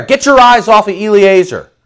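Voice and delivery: high, loud, commanding proclamation; booming voice of God. Acted. Preacher booming God's words to Abram. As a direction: thunder it out as God's decree.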